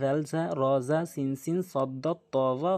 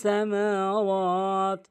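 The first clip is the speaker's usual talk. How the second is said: slow, higher, drawn-out tajweed chant; Quran recitation; 5 semitones higher, much slower than usual. Shown as a demonstration. Teacher recites the verse for learners, measured Quran recitation. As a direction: recite the verse with long held vowels.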